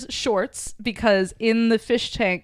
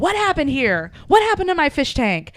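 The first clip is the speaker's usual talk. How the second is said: higher-pitched mock dismay; retold alarmed demand; 6 semitones higher than usual. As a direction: act out a roommate demanding an explanation. Retelling Schmidt discovering broken fish tank.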